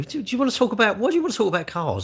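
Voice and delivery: high, disbelieving question; why would anyone ask that; bemused pitch-up at a viewer's question; incredulous. Real, not acted.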